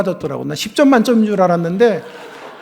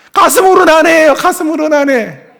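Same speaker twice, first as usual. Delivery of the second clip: much higher, louder burst, then slower; emphatic exclamation; 11 semitones higher, 8 dB louder than usual. Real, not acted. Preacher moved by his own story, high and loud. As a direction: exclaim it from the chest. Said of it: "preacher's emphatic aside".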